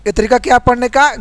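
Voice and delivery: ordinary delivery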